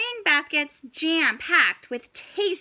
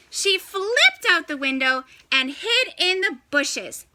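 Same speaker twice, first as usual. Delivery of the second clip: ordinary delivery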